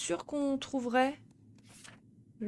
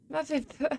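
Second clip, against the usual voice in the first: scared horror game streamer; 5 semitones higher than usual. Real, not acted.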